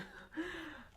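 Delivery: soft, high giggle; self-amused laugh. Real, not acted. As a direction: giggle quietly at your own botched pronunciation. Laughs at own pronunciation attempt.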